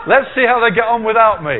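laughing retelling. Retold or imitated. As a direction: laugh through the lecturer's joke. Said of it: "he said".

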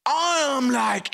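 normal talking voice

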